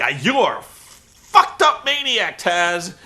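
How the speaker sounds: high, loud, slow sneering jab; mock commentator recoiling from his partner; caricatured disgust. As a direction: drag it out with disgust. Tenay insults Taz.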